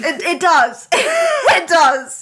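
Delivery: high, loud, laughing protest; laughing insistence. Real, not acted. Storyteller insisting to the cohost, laughing and loud. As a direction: insist through bursts of laughter.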